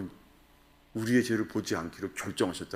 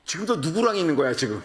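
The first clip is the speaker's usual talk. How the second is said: much higher, louder accusing voice; suspicious forties spouse; 8 semitones higher, 5 dB louder than usual. Retold or imitated. 바람 의심하며 몰아붙이는 사십 대 배우자. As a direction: accuse a partner of cheating. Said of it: "사십 대가 넘어가면은요".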